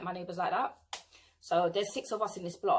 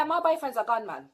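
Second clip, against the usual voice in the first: ordinary delivery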